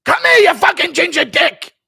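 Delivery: high, loud belligerent yell; Matt Serra impression. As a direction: shout it like you're starting a backstage fight. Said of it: "Impression of Sarah trying to fight".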